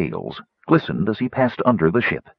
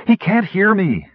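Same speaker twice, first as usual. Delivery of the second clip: high, exasperated complaint; peeved; 11 semitones higher, 3 dB louder than usual. A narrator's reading. Kitiara finds the dragon ignores her call, peeved.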